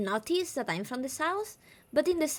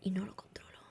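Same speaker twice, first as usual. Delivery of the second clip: whispered; 5 semitones lower, 8 dB quieter, much faster than usual. Shown as a demonstration. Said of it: "se me va a este tono".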